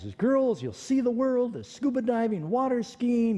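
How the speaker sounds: high, eager selling voice; excited sales pitch. Retold or imitated. Dad talking his son into boat school, high eager pitch. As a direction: pitch it like it's paradise. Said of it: "And he says".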